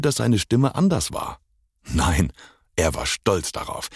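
usual speaking voice

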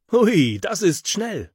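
high, slow whooping delight; thrilled. A narrator's reading. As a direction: ride the rush of speed. Thrill on the slide. (begeistert jauchzend)

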